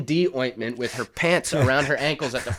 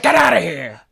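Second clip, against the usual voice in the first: gruff shoo; 4 semitones higher, 7 dB louder than usual. Retold or imitated. Naked grandfather shoos the boy.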